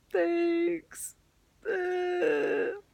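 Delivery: high-pitched whimpered thanks, imitated; hammed-up weepy little reply; mock tearful. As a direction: squeak it out as if crying. Retold tearful reply to comforting coworker.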